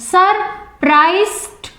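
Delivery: Surprised broken up as Sur-prised; split into syllables. Shown as a demonstration. Teacher demonstrating syllable breaks, Surprised said piece by piece. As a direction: say Surprised in separated beats with a gap. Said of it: "three syllables".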